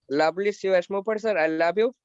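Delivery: normal talking voice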